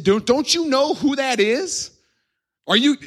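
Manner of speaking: high, drawn-out, stammered protest; indignant disbelief. Acted. Crowd warns Jesus who that is, acted indignation. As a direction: protest in hammed-up outrage. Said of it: "they were angry".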